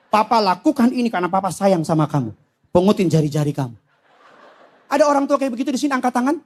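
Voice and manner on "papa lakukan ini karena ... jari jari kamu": low, deadpan fatherly explanation; lightly voiced stern papa, dropping lower; mock calm father. Papa explains punishment to child, low mock-loving calm.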